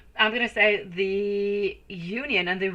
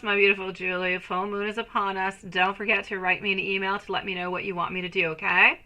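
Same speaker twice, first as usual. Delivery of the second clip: ordinary talk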